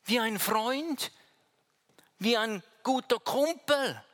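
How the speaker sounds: voicing Peter. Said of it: "Er sagt".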